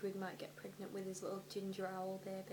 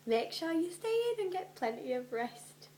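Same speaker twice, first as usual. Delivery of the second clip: high, louder fussing mum voice; mock motherly warning; 9 semitones higher, 6 dB louder than usual. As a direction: put on a high nagging mother. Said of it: "she had warned him".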